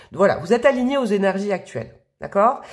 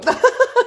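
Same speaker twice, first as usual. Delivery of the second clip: a sudden high, loud giggle; high burst of laughter; 6 dB louder than usual. Real, not acted. Laughing at herself over her sniffles, a high giggle.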